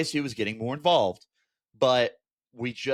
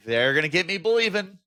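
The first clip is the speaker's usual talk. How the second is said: louder and higher, hammed-up dread; half-shouting at his own hope; a playful fan's groan; mock exasperation; 6 semitones higher, 5 dB louder than usual.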